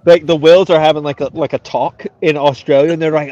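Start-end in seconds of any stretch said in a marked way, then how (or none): none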